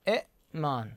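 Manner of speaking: "إئمان" as i'-maan, short i cut by a glottal stop; original hamza form. Shown as a demonstration. Teacher showing the word's origin, "إئمان" with a glottal catch. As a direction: stop the breath after the first vowel of "إئمان". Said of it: "همزة ساكنة وقبلها همزة مكسورة".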